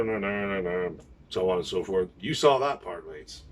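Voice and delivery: ordinary delivery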